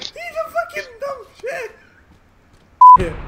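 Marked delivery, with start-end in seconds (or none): 0.0-1.7 whispered through laughter; laughing whisper. Real, not acted.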